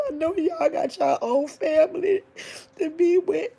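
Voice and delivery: real high, teary crying; thanks choked out through tears; tearful crying, pitched high. Real, not acted. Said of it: "listening to me cry".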